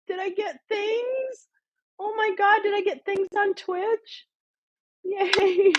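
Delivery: high, teary excited squeak; giddy high voice wrung out by real laughing; laughing to tears. Real, not acted. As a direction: squeal it happily, half crying from laughter. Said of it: "I'm really crying".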